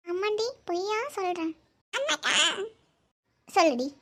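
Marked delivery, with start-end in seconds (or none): 1.9-2.3 put-on child voice. Voicing child.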